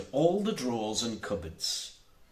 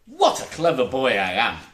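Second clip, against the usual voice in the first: loud boastful crow; 8 dB louder than usual. A narrator's reading. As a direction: brag it out loud like a proud boy.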